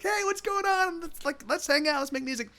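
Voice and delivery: bubbly host inviting fun; upbeat party voice. Retold or imitated. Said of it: "I'm normally like".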